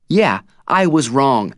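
loud, slow shout. A narrator's reading. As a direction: shout it out, slowly. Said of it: "I'm shouting now".

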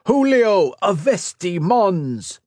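high, loud, drawn-out spell casting; shouted incantation. A narrator's reading. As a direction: call out the magic words with full force.

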